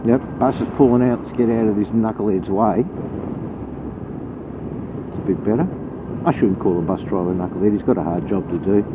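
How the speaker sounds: normal talking voice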